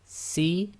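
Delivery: "Si" as a light, hissed s with short i; crisp sin sound; Arabic sin with kasra. Shown as a demonstration. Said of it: "letter demo".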